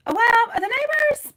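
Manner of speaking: high drawn-out neighbors. Acted. Reader teasing the client about neighbors, high slow drawl. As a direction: lift "neighbors" way up and stretch it slowly.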